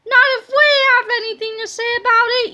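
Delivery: put-on fast, high boyish yell. A narrator's reading. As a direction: shout it back at the villain, quick and high. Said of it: "yelled George".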